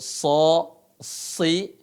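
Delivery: SO, SI with heavy emphatic s; huruf so tebal. Shown as a demonstration. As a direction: hold a thick s, colour "si" toward O. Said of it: "dibaca tebal". (baca "so, si" tebal dan pelan)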